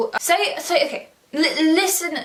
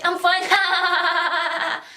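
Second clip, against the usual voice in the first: mock manic laugh; 4 semitones higher than usual. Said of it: "don't say".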